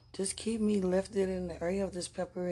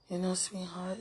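normal talking voice

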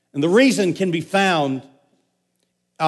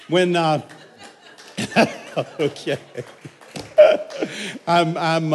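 laughing. Real, not acted. Still laughing after slip.

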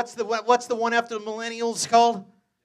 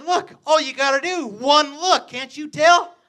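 mock boast; 8 semitones higher, 7 dB louder than usual. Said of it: "I said".

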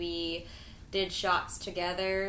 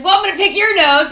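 loud; 10 semitones higher, 17 dB louder, faster than usual. Retold or imitated. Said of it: "he's like".

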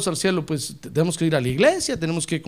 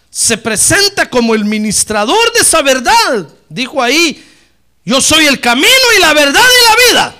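louder, rising preacher's proclamation; fiery preaching; 6 semitones higher, 8 dB louder than usual. Acted. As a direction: drive the truth home from the pulpit. Preaching build-up.

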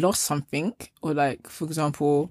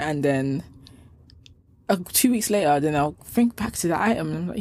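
ordinary delivery

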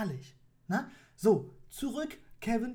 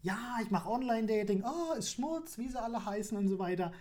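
ordinary talk

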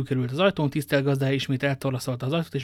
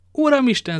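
higher, loud booming call; 10 semitones higher, 7 dB louder than usual. A narrator's reading. Athos calls out from behind the cellar door, booming. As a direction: boom it out through the door. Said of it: "Athos öblös hangja".

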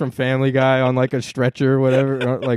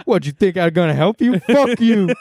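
mock Biden; 6 semitones higher, 3 dB louder than usual.

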